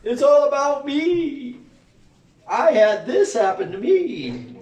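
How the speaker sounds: high, loud, drawn-out mock bragging; mocking self-centred people. Mimicking everybody who makes it about them, mocking wail. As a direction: wail it slowly, hammed-up and self-important. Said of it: "everybody on the planet wants to make it about them".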